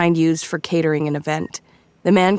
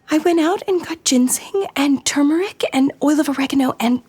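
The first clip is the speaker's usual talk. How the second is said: much higher, anxious rambling; nervous high babble; 10 semitones higher than usual. Acted.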